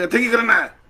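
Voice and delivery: loud, high furious demand; angry shout. Retold or imitated. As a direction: bark it at him from far above. Angry guru quoted. (गुस्से से चिल्लाकर)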